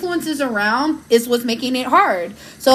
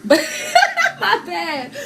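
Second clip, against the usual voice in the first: laughing apology; 7 semitones higher than usual. Real, not acted. Apologising for camera motion.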